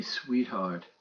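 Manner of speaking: soft, low and tender. Acted. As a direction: say it close and intimate. Tender line in scenario two.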